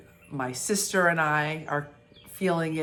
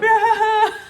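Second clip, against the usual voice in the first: imitated shriek; 11 dB louder than usual. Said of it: "And you were like".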